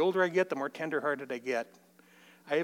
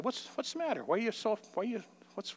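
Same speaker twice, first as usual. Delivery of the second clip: soft, higher, tender questions; gentle asking; 7 semitones higher, 5 dB quieter than usual. Retold or imitated. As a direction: ease him open gently. Gently asking crying grandson.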